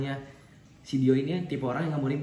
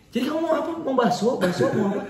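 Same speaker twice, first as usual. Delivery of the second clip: high, raised-voice retold pestering; mock exasperated demand; 9 semitones higher, 4 dB louder than usual. Boyfriend presses girlfriend to choose bakso, mock exasperated high voice. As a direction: imitate an impatient order-taker, high and half laughing. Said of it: "ngomongnya gini".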